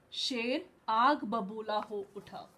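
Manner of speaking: plain reading voice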